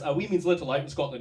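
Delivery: ordinary talk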